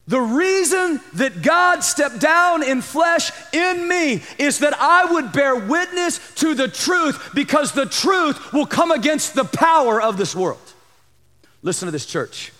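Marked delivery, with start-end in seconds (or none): 0.1-10.7 raised, high-pitched proclamation as Jesus; impassioned preaching. Acted. Preacher voicing Jesus' purpose to his church, impassioned. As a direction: drive it home, pitched well up.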